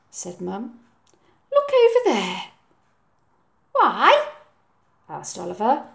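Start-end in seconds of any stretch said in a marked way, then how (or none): 1.5-2.4 high, lilting direction; higher voice for mum; mum pointing. Acted. Mum's voice. 3.7-4.3 put-on child asking. Oliver's voice.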